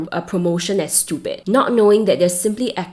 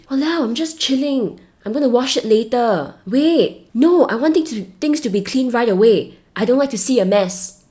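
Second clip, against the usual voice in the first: put-on strong Singlish accent, higher and brighter pitched; 5 semitones higher than usual. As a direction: play it up in a thick Singlish accent, complaining. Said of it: "I'm using my Singlish accent very strongly".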